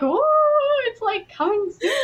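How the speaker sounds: excited. Retold or imitated. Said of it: "And I'm like".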